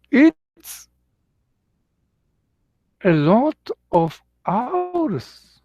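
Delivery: high, drawn-out amazement at the amount; slow, high-pitched retold astonishment; imitated disbelief. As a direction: quote him incredulously, stretching the words out slowly. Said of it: "he couldn't believe".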